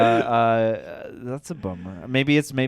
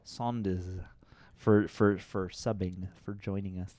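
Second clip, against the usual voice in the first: very soft, slow and halting; odd hushed voice; 4 semitones lower, 11 dB quieter than usual. Acted. Thanking a new subscriber, in an odd hushed voice. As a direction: let the thanks sink away softly. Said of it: "why I chose to speak that way".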